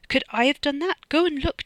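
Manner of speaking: high, loud frantic pleading; desperate protest. A narrator's reading. Freddy urges suspicious Dono to check, desperately protesting. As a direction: protest innocence, voice pushed high.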